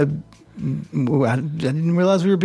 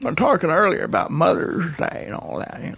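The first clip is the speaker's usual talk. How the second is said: put-on old grandpa voice; 3 semitones higher than usual. Grandpa character.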